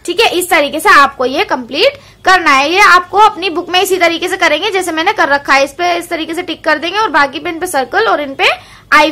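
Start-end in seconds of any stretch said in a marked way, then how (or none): none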